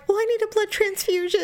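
high, hammed-up fretting; mock panic. Joking about her cut, high mock-panicked voice. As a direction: play up the drama with amusement.

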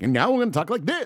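high, loud mock radio announcer. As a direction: put on an exaggerated on-air voice. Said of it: "when people get onto the, again, the radio".

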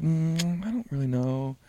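soft, hesitant quote of someone pondering; unsure, trailing softly after a hummed pause; thinking it over. Retold or imitated. Wife unsure what she wants for Christmas, imitated pondering. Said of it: "she's thinking".

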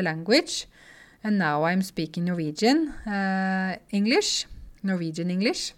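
English with a very Norwegian accent, slow and deliberate; overdone Norwegian-English with up-and-down intonation; exaggerated Norwegian accent. Shown as a demonstration. Norwegian teacher introduces herself in English to show the accent, exaggerated Norwegian accent. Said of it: "snakke litt sånn norsk-engelsk".

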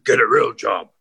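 lightly voiced dismissive Trump; a hint of a sneering president; mock Trump dismissal. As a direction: toss it off like a scornful tycoon. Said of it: "He's gonna go".

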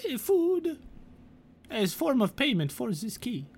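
high prim goblin bargaining for the key. Acted. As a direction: bargain primly in a high, proper voice. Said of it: "she's like".

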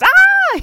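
loud, high startled scream; sudden full-volume mock scream; acted scream. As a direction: shriek as if a stranger jumped in. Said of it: "gets in your car and goes".

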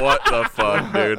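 high, loud, laughing disbelief; shocked outburst. Retold or imitated. Retelling his reaction to a bizarre movie line, laughing in shock. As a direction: blurt it out in stunned disbelief. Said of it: "I was like".

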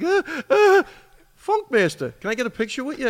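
put-on high, halting, eager kid voice asking for a photo; starstruck young fan. A tearful young fighter spots his idol and asks for a picture, high starstruck voice. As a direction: pitch it way up, stammering like a starstruck kid.

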